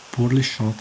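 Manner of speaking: ordinary delivery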